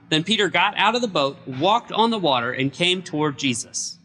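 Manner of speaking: usual speaking voice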